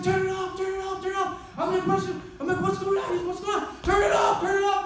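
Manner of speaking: high, loud frantic yelling; panicked shouting. Retold or imitated. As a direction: scream it in panic.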